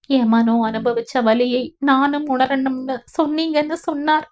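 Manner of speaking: high-pitched, plaintive recounting; weepy, distressed wife's voice; tearful. A narrator's reading. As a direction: pour it out as if crying. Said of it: "கண்ணீருடன் வருடினாள்".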